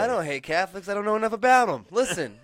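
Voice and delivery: high, loud mock-earnest plea; hammed-up insistence that you mean well. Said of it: "quoting [name] bit".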